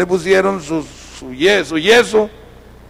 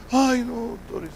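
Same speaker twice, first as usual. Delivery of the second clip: put-on high, soft boyish protest; pleading child, imitated; 7 semitones higher, 9 dB quieter than usual. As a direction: play a small boy begging the doctor. Boy refuses crooked arm. (niño quejoso, imitado)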